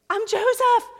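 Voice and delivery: high, loud cry of the name; anguished shout. Acted. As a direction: shout it out, voice cracking with emotion. Joseph's reveal retold.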